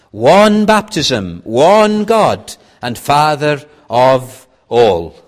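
聖書を力強く朗読; scripture reading. Acted. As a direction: read the verses out loud like a preacher, leaning on each "one". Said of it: "Let me read some verses".

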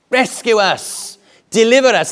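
high, urgent crowd plea; calling out. Acted. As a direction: call out for rescue, pitched well up. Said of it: "they called out to Jesus".

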